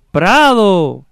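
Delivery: high, drawn-out distant call; echo. Acted. Voice answering from the other side, echoing call. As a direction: stretch it out like an echo, falling at the end. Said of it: "el eco es todo".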